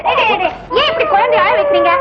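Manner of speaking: fast, high scolding; rushed scolding. Acted. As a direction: hurry in scolding them both. Scolding over the crying baby. (வேகமான உயர்ந்த குரலில் கடிந்துகொள்ளல்)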